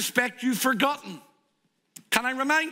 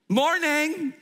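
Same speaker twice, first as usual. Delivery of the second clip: cheery greeting; 7 semitones higher, 3 dB louder than usual. Acted. Ben greets the coffee manager.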